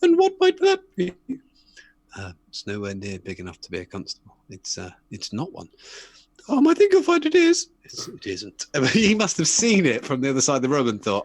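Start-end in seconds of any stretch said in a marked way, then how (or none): 0.0-1.1 very high falsetto lady, loud; unsuspecting owner in mock falsetto; woman on Antiques Roadshow. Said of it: "And she says". 2.2-5.7 soft, low expert's verdict; understated, reluctant expert; antiques expert breaking bad news. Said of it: "He goes". 6.5-7.7 very high falsetto lady, loud; unsuspecting owner in mock falsetto; woman on Antiques Roadshow. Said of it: "And she says". 7.8-8.6 soft, low expert's verdict; understated, reluctant expert; antiques expert breaking bad news. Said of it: "He goes".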